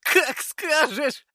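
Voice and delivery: very high, drawn-out character voice; long, high-pitched dismissal; waving it off. A narrator's reading. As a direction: brush it off in a high squeal. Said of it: "отмахнулся Леший".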